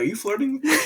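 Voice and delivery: high-pitched mock coy question; playfully flattered. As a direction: pitch it up, as if being flirted with. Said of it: "And I'd be like".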